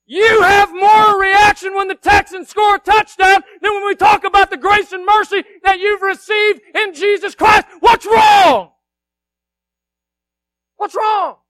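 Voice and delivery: high, very loud scolding yell; yelled preaching. Real, not acted. Preacher challenging a congregation that won't react, yelled rebuke. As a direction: berate the congregation at full voice. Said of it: "'cause I yelled".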